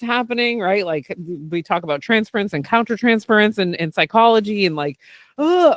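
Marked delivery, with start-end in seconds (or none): 5.4-5.7 high mock-panicked groan; retold freak-out. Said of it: "I would freak out".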